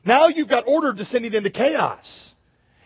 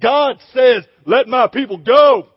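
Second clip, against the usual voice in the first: booming movie-hero voice, much louder; studly epic-film prophet shouting; big heroic Moses; 3 semitones higher, 7 dB louder than usual. Retold or imitated. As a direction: thunder it at Pharaoh like a Hollywood hero. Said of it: "Charlton Heston-esque, you know, Val Kilmer kind of voice".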